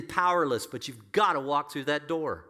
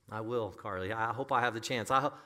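ordinary talk

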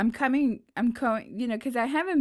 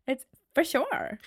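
put-on Valley Girl demo; 5 semitones higher than usual. Shown as a demonstration. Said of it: "Well, it's bad".

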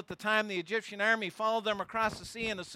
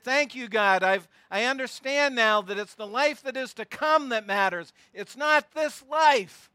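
fervent thanks; 4 semitones higher, 7 dB louder than usual. Retold or imitated. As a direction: swear it with growing fervor. Rescued believer thanking God.

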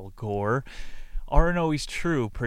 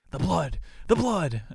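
hammed-up delirious shout about blood; mock frantic raving; 6 semitones higher than usual.

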